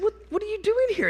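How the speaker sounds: high, fast startled question to his dad; blurting it out in surprise at dad home early; shocked question. Retold or imitated. Son to father caught at breakfast, high startled question.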